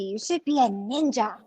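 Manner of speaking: ordinary talk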